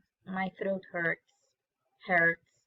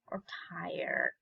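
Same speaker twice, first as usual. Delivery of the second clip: a soft, tired whisper on the last choice; 3 semitones lower, 6 dB quieter than usual. Pretend. Teacher miming tiredness for students, whispered. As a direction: sigh the word out like you're worn out.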